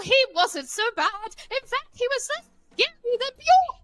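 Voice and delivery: very high, bouncy storybook sing-song; a high-voiced riddler showing off his rhyme; high singsong riddler rhyme. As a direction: recite the rhyme like a giddy little riddler. Player recites a rhyming riddle in character.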